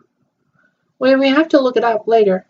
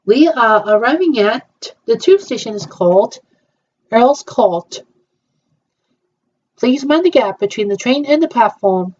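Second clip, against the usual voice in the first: train announcer. Pretend. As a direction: call the stop like the Tube speaker. Tube train announcement play.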